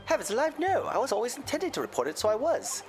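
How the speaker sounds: a lilting Irish brogue; Irish accent. Acted. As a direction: tell it like an Irish witness. McGilded in accent.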